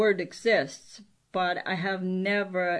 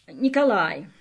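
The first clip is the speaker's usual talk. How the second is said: ordinary talk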